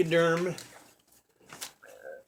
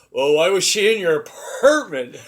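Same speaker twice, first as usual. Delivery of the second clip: dad's line, louder and breaking into a laugh; 6 semitones higher, 7 dB louder than usual. Retold or imitated. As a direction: deliver the punchline as the laugh takes over. Retelling dad's punchline.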